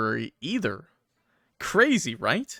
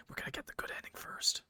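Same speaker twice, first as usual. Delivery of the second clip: whisper; 12 dB quieter than usual. Real, not acted. Aside to chat, secretive.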